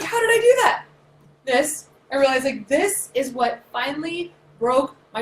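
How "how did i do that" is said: high, loud disbelief; high amazed squeal. Retold or imitated. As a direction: marvel at yourself out loud. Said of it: "And I was like".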